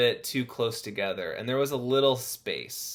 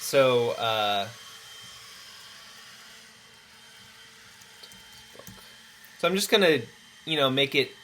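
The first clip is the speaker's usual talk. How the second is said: yelling over leaf blower; 3 semitones higher than usual. Real, not acted. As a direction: raise your voice over the blower. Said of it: "Sorry about the blow-, air, leaf blower".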